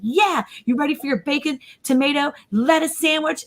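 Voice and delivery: high, eager build-up, re-enacted; excited. Mom hyping BLTs to her six-year-old, excited. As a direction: hype up the sandwich like a treat. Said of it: "I'm all excited".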